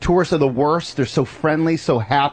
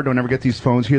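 ordinary delivery

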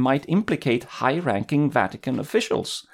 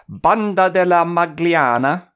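hammed-up Italian accent, slow; fake Italian accent; 5 semitones higher, 4 dB louder than usual. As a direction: roll out "Banda della Magliana" in Italian. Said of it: "I hope my Italian, fake Italian is working".

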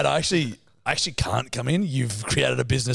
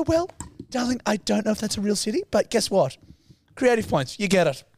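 higher-pitched doting mum voice; Jill; 6 semitones higher than usual. Retold or imitated. Mum Jill awarding creative points, high doting voice. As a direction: indulgent mum gently letting a silly answer pass. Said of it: "And Jill would go".

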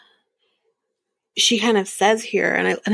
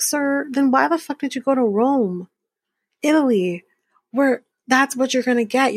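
incredulous, mildly raised question; exasperated; 5 semitones higher than usual. Real, not acted. Vlogger mocking a dieter who went to Rome, incredulous. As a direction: ask it like you can't believe him.

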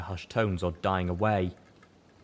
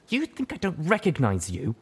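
accusing; 8 semitones higher than usual. A narrator's reading. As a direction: accuse him outright. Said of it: "Tracker accused".

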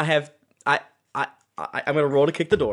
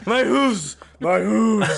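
higher, hammed-up lament; playful wail of the wounded; mock agony; 6 semitones higher, 3 dB louder, much slower than usual. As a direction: echo a melodramatic cry of pain. Echoing the satyr's mock agony.

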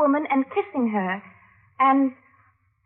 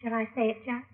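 much lower and softer, intimate; confiding a secret; 4 semitones lower, 10 dB quieter, faster than usual. Acted. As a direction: lean in and confide it quietly. And he whispered.

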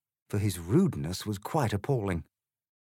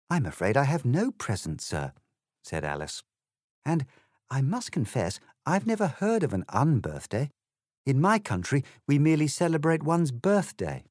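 put-on a slightly higher, prim girl's voice; polite Alice, lightly voiced; 6 semitones higher than usual. Alice politely answers the egg, lightly voiced girl. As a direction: explain it courteously, a proper little lady.

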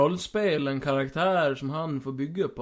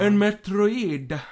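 mock awe; 6 semitones higher, 6 dB louder, slower than usual. Skit continues.

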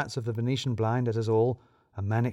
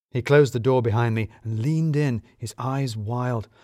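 usual speaking voice

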